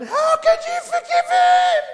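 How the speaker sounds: high-pitched, drawn-out screech, imitating a hysterical woman; crying out. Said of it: "cried out saying".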